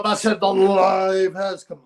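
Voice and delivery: drawn-out announcer voice; slow, booming hype-man call; slow mock announcer.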